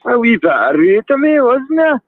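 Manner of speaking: put-on high, loud Irish accent. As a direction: ham up a lilting Irish accent. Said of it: "my Irish accent's better".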